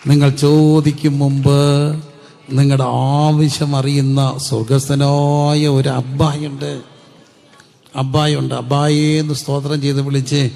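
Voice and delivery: loud, slow fervent preaching; full-force preaching. Acted. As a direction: proclaim it loudly to the congregation. (ഉച്ചത്തിൽ ആവേശത്തോടെ പ്രസംഗിക്കുന്നു)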